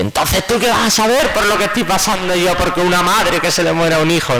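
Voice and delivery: high, sharp and indignant; bereaved mother rebuking the nurse who claimed to understand, high and indignant; grieving mother, outraged. Retold or imitated. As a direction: throw the question back at him, wounded.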